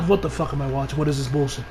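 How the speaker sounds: low, soft flat disbelief; quiet disgusted aside; deadpan disgust. Retold or imitated. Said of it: "I'm like".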